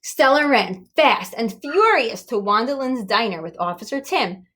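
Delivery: usual speaking voice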